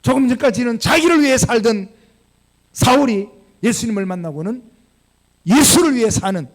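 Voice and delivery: full-force pulpit preaching; fervent preaching. Acted. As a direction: build to the climax with force.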